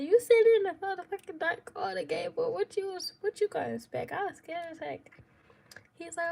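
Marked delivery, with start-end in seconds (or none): 0.0-4.1 high, loud incredulous outburst; retold scolding. Scared woman scolding her partner in the dark, retold outburst. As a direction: replay a startled telling-off, pitched up.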